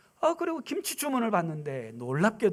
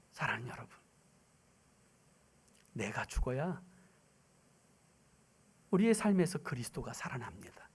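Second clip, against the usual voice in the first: whispered, much softer, low; hushed pulpit whisper; intimate whisper; 7 semitones lower, 6 dB quieter than usual. Real, not acted. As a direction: lean in and whisper to the congregation. Preacher's whispered appeal.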